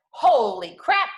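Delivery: amazed outburst; surprised exclaim. Real, not acted. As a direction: blurt it out in shock. Reacting to money.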